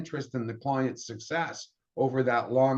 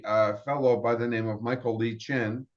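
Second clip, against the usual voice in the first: ordinary talk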